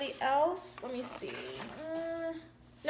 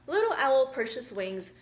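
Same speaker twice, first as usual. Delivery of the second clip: usual speaking voice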